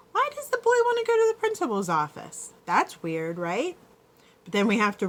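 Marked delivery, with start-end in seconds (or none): 0.1-3.8 thinking aloud. Shown as a demonstration. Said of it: "now we have to think".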